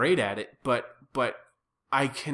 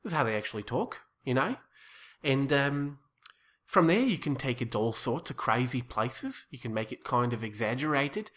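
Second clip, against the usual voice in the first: relaxed everyday Australian bloke; Australian accent. Shown as a demonstration. Improviser showing the host a baseline Australian accent. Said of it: "my Australian accent is good".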